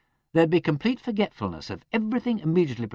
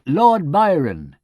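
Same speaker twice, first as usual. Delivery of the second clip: eager cry, louder and slow; prompt cry; 3 semitones higher, 11 dB louder, much slower than usual. Acted. Kirk naming the poet too promptly, crying out. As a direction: blurt it out proudly. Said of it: "a little too promptly".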